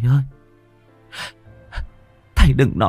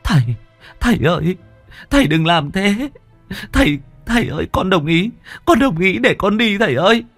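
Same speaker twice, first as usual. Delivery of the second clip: much higher, tearful daughter giving in; crying surrender; 10 semitones higher than usual. Acted. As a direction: beg him to stop, voice breaking high. Daughter stops kneeling father, gives in.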